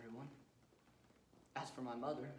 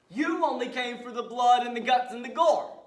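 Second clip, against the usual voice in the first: much higher, booming taunt; explosive, high-pitched roar; sudden shout; 10 semitones higher, 19 dB louder than usual. Acted. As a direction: hurl it at the audience like an accusation. Killer taunts audience about gore.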